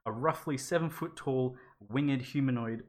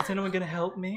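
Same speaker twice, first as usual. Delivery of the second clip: hammed-up helpless plea, higher; mock helpless; 7 semitones higher than usual. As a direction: whimper for help like a fake victim. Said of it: "He's like".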